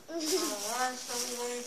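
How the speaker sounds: mumbling through stuffed cheeks; cheeks stuffed full. Real, not acted. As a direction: mouth full of marshmallows, laughing. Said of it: "there's a bunch of marshmallow on my cheeks".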